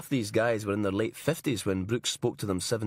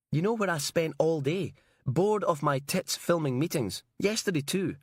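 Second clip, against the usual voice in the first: put-on higher, whiny young man; young cameraman voice; 7 semitones higher than usual. A narrator's reading. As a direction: complain like a bored young lad. Cameraman Alec complaining.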